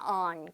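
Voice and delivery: "on" with a vowel between ah and oh, low and soft; sound between a and o. Shown as a demonstration. Teacher showing the English vowel in "on". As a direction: open "on" toward ah. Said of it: "아랑 오랑 이렇게 사이에 있는 느낌으로 on".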